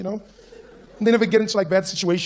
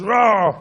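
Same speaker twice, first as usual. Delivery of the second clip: loud, raised roar; mock roar; 5 semitones higher, 6 dB louder than usual. Comic acts out wanting to punch him, roaring. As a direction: let out a pretend fighting roar. Mimes his urge to punch.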